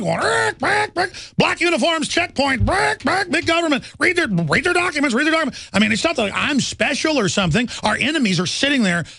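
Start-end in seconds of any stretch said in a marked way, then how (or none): 0.2-5.7 high-pitched caveman squawking in mock panic; caveman freaking out. Host mocks himself as a throwback caveman, freaking out. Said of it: "like a throwback caveman freaking out".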